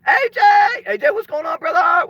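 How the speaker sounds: high, loud shout of the name; excited shout. Real, not acted. Streamer spots A J in the chat, excited high shout. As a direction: hail a buddy who just showed up.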